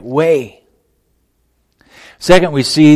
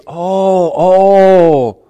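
drawn-out, higher "oh" as it clicks; dawning realization; 6 semitones higher, 5 dB louder, slower than usual. Retold or imitated. Disciples finally grasping Jesus' words, acted dawning realization. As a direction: act out the penny dropping.

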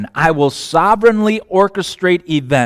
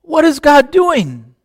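high, slow, bewildered cry of what is God doing; exasperated question; 11 semitones higher, 6 dB louder, slower than usual. Retold or imitated. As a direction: throw the question up in frustration. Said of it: "and I say".

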